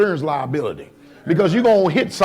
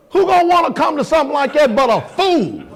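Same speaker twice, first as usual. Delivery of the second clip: higher, louder preaching that builds to a shout; fiery preaching; 8 semitones higher, 3 dB louder than usual. Real, not acted. Preacher calls out the congregation in fiery preaching.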